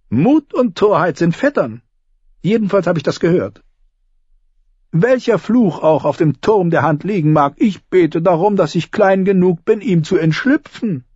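wry self-mocking joke; dry humour; Tyrion voice. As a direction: make light of the danger. Tyrion jokes about the tower.

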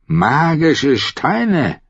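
very slow, high tipsy swearing; drunken oath. Acted. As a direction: drag out each word as a drunk swears. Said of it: "schwor er". (sehr langsam, hoch, betrunken beschworen)

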